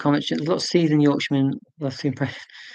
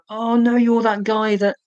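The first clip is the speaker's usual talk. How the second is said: high, drawn-out mock dismay; acted dismayed realisation; 8 semitones higher, 4 dB louder, slower than usual. Streamer retelling spotting the man they offended before, in mock dismay. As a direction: play up the sinking realisation. Reenacts horrified realisation of repeated mistake.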